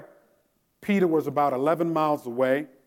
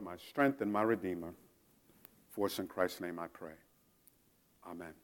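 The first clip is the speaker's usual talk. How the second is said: hushed, low prayer voice; 6 semitones lower, 6 dB quieter than usual. Real, not acted. Pastor praying over the congregation, hushed. Closing prayer before sermon.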